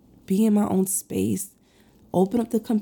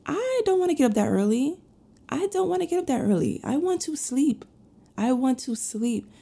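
whiny reluctance; 6 semitones higher than usual. Acted. Mom dreading the early alarm, whining in a high voice. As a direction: whine it like you can't face getting up.

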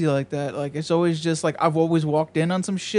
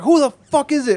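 higher, louder gruff yell through a door; mock dealer yell; 10 semitones higher, 7 dB louder than usual. Dealer yelling at a knock on his door, a mock loud shout. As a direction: bark it like a suspicious dealer behind the door.

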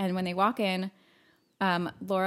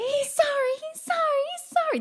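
very high, louder, mocking repetition; crazed mocking witch; 4 dB louder than usual. Retelling Nancy mocking Chris, crazed high taunt. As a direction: sneer it like a witch losing her mind. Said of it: "her head is, like, basically spinning".

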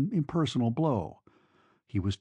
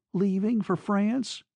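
high, slow rising question; softness gone; 8 semitones higher, slower than usual. A narrator's reading. Girl learning her lover is leaving, high with softness gone. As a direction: draw out the question, pitched high. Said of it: "the softness was gone from her voice".

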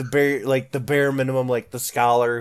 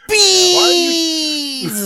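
long, loud, high-pitched shriek on "Bees"; hammed-up drawn-out wail; mock scream; 12 dB louder than usual. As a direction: scream the word and hold it. Joke scream amid group laughter.

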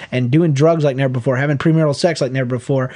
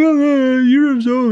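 high, tearful mock gush; hammed-up teary voice, much higher; mock crying; 11 semitones higher, 3 dB louder, much slower than usual. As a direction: imitate yourself crying with your daughter. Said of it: "and I'll cry".

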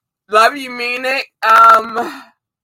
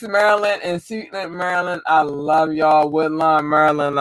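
ordinary talk, nothing marked

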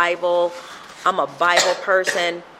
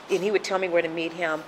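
usual speaking voice

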